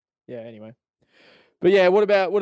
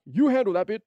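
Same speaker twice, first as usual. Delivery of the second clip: higher, louder put-on actor voice; mock Tommy Lee Jones; 8 semitones higher, 4 dB louder than usual. Tommy Lee Jones passing the rap bit, lightly voiced. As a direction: palm it off with a grin. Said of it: "He would have been like".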